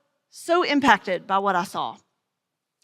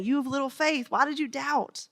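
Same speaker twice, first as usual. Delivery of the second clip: gentle soft rebuke; 8 dB quieter than usual. A narrator's reading. Jesus gently rebukes Peter.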